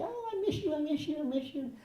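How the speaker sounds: put-on very high, gushing child's voice; adoring little girl. Little girl hugging her father figure, excited adoration. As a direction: gush like a child mid-hug.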